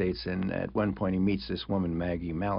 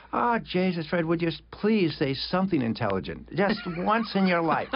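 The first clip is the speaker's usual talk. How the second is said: teasing friend; 8 semitones higher than usual. Retold or imitated. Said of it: "and he said".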